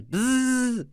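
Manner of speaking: squealed high buzz; high buzzing noise. Acted. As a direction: buzz like a frantic DJ. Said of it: "remember the bzzz".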